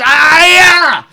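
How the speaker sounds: high, drawn-out fighting cry; mock karate yell. Retelling how he kicks the gate, mock karate yell. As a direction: imitate a kicker's battle yell. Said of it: "He goes".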